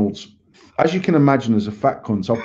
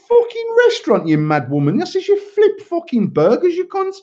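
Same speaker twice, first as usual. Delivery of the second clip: 1 retold mocking yell. Retold insults to manager.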